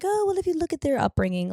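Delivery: high, soft know-it-all friend; a lightly voiced patient friend; gentle therapist friend.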